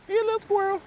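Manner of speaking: high squeaky cooing at the squirrel. Real, not acted. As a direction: coo at it like a tiny pet.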